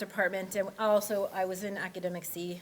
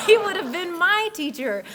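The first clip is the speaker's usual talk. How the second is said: laughing; 9 semitones higher, 8 dB louder than usual. Real, not acted. Laughing at a funny what-if.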